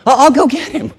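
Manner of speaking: high, loud, overwrought; nearly breaking, she insists; emotional. Acted. Mary desperate to fetch the body, high and overwrought. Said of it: "She's just emotional".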